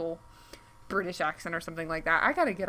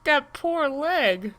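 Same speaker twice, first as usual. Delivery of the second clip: slow, high, loud horrified pity; 8 semitones higher, 8 dB louder, much slower than usual. Real, not acted. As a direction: drag it out, wincing at the sight. Pitying a gruesome leg photo.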